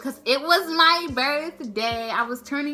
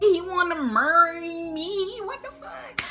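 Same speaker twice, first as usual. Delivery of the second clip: high, drawn-out incredulous question; amazed disbelief; 7 semitones higher, 3 dB louder, slower than usual. Retold or imitated. Looking at the ring on her hand, stunned disbelief. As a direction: stretch it out high, then drop the volume.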